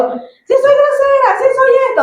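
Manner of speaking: high mock whine. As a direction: mimic herself yelling back in a high squeaky voice. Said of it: "yo a veces le digo".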